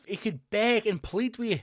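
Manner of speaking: ordinary delivery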